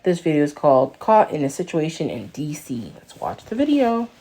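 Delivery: loud, upbeat channel intro; big, projected host greeting; video intro. Acted. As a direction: announce the video loudly to your viewers. Opens own video as host.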